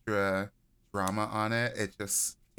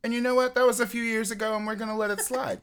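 quick, higher attempt at an Australian accent; 11 semitones higher, faster than usual. Host trying the promised Australian accent, fast and higher. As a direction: rattle it off in a shaky Aussie accent. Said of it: "an Australian accent".

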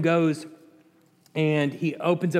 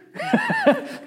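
high laugh breaking out; bright burst of laughter. Real, not acted. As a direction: laugh at your own joke. Laughs at his own joke.

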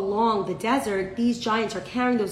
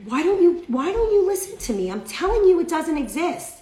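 raised, high exasperated rebuke; scolding lecture; 8 semitones higher, 5 dB louder than usual. Acted. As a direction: plead and scold like an acted reprimand. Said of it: "He's giving him musar".

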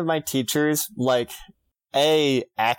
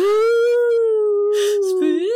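high wolf howl at the moon; 5 dB louder than usual. Pretend. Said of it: "That's my wolf howling at the moon".

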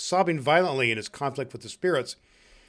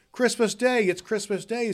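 high-pitched glad exclamation; excited announcement; 8 semitones higher than usual. Acted. Someone tells Scrooge the day, excitedly. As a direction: exclaim the happy news.

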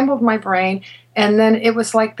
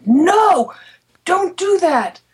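high, urgent begging, voice pitched up; frantic pleading; 8 semitones higher, slower than usual. Retold or imitated. Doctor begging patient not to tell her supervisor, high frantic plea. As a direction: plead with him to stop, panicked.

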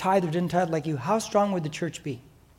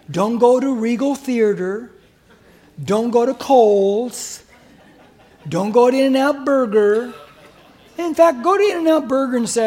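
laughing; 3 semitones higher, 6 dB louder than usual. Real, not acted. Preacher laughing at his joke.

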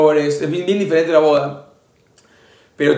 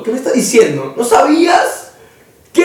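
incredulous, climbing high to a loud "qué"; mock-astonished questioning; retold disbelief; 7 semitones higher, 3 dB louder than usual. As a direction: act out being floored by news. Retelling disbelief at Kio's news.

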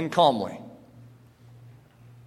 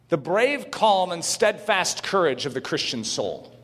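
normal talking voice

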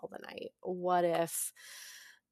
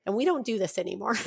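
ordinary talk, nothing marked

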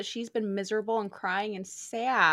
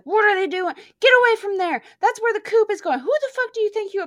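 loud, higher furious shout; spitting mad; 11 semitones higher, 10 dB louder, faster than usual. Retold or imitated. Woman yelling at strangers by the coop, spitting mad. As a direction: chase them off in a rage. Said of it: "fucking spitting mad".

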